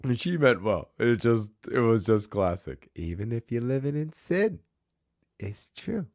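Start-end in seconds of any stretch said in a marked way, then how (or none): 3.0-6.1 put-on Boston accent. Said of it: "get back into the accent".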